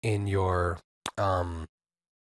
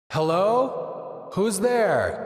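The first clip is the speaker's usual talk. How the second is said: calling out into a reverb wall; cave echo; 8 semitones higher, much slower than usual. Shown as a demonstration. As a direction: call into a blurred wall of reverb. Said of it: "You see a wall of sound".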